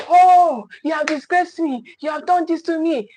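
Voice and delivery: imitating a wounded, screaming parent; mock screaming parent. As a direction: act out a parent screaming at the child. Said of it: "You just be screaming".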